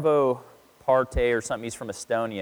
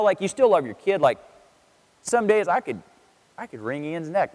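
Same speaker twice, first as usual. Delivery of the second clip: usual speaking voice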